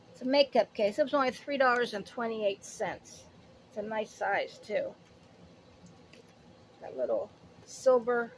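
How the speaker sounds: ordinary talk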